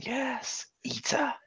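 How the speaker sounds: hushed, high mock-sinister whisper; villainous whispered relish, imitated; gloating whisper. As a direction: secretly egg the monster on, very softly. Retold gloating onlooker at the glass.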